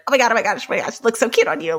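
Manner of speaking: breathless run of delighted compliments; giddy excitement. Retold or imitated. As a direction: gush fast like a giddy little kid. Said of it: "like a giddy little kid".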